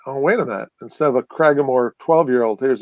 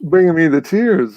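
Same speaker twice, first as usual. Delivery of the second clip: mock tearful; 3 semitones higher than usual. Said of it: "getting choked up about".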